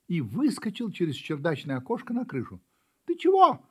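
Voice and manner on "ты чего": high loud call from the roof; shouting. Acted. Kitten shouts down from the roof to the puppy. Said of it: "закричал котёнок щенку".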